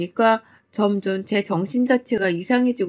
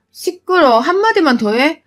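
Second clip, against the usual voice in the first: sharp angry shout; 5 semitones higher, 3 dB louder than usual. Acted. Mother-in-law silencing daughter-in-law.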